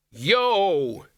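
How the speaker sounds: high, drawn-out call; whoop. A narrator's reading. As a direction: let out a long, high whoop. Wizard whoops with permission.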